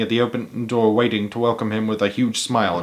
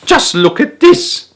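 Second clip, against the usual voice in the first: much higher, louder and slow; excited shout; 6 dB louder, slower than usual. A narrator's reading. Lady calling her neighbour to look, thrilled. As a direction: shout in delight, drawn out. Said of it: "she was shouting".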